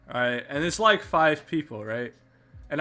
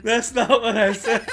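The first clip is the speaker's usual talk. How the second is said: laughing; 8 semitones higher, 7 dB louder than usual. Real, not acted. Protesting while laughing.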